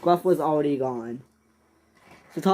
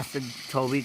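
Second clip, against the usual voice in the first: plain reading voice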